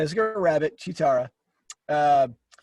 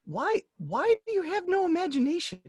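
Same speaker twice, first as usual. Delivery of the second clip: high-pitched, softer whine of protest; exasperated plea; 10 semitones higher, 5 dB quieter than usual. Real, not acted. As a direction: plead it in a high, frustrated voice. Exasperated retort to criticism.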